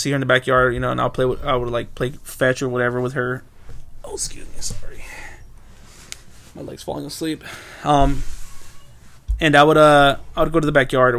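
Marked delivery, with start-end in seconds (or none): 4.0-7.4 whispered aside. Real, not acted. Aside apology mid-story.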